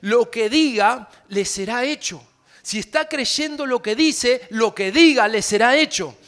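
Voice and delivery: emphatic sermon voice, loud and slow at first; preaching. Acted. Pastor preaching the verse to the congregation, emphatic. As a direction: proclaim the promise to the congregation.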